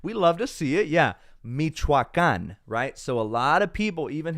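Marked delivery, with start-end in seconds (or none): 0.9-2.6 Michoacan, drawn out slowly with the stress on the last syllable. Shown as a demonstration. Teaching a viewer how to say Michoacan. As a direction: spell out Michoacan syllable by syllable, ending on a stressed -can. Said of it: "thank you for saying Michoacan out loud".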